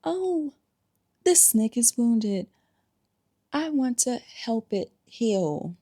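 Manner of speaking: lightly voiced naive savior, fading to plain; mock naive healer. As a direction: play the gushing love-and-light helper. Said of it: "your love and light behind".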